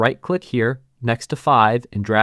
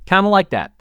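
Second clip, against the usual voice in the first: normal talking voice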